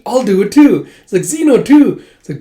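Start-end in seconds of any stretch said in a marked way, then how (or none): 0.0-0.9 louder, higher eager chime-in; retold eager "me too"; eager volunteering. 1.2-2.0 high, loud eager chime-in; excitable retold character voice; eager joining in.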